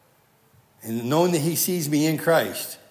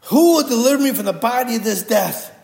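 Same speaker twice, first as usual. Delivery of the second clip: screaming; 7 semitones higher, 7 dB louder than usual. Retold or imitated. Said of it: "He's screaming".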